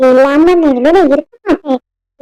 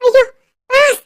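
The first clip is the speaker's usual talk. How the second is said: high-pitched, distressed wail over a loss; frantic, high voice reporting something precious missing; panicked; 6 semitones higher than usual. Acted. Lily tells her boss her plant is gone, panicked.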